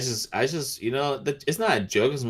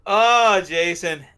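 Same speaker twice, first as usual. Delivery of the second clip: knowing tease; 9 semitones higher, 7 dB louder, much slower than usual. Real, not acted. Teasing Jason with secret.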